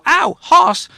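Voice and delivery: put-on sudden high squeal of a child touching something hot; child's ouch. Child touching the hot shelf yelps in pain. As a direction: yelp it like a kid who touched the shelf. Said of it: "they're gonna go".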